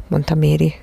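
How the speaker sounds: ordinary delivery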